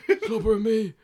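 hammed-up reassurance; imitating friend. Friend promising the hat back, hammed-up reassurance. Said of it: "He's like".

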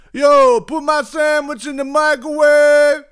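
high, slow, stretched-out yell; mock hollering. Man calls up to woman on the balcony, slow high mock holler.

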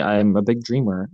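usual speaking voice

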